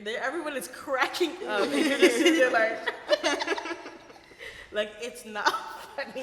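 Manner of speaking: words broken by loud real laughter; laughing. Real, not acted. Laughing while retelling being mocked.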